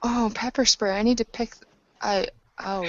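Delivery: usual speaking voice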